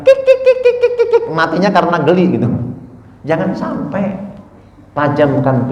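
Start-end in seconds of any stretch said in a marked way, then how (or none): 0.0-1.2 very high squeaky giggle of a tickled chicken; chicken giggling. Retold or imitated. Preacher mimics a tickled chicken, squeaking high. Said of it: "jadi ayamnya itu kegelian".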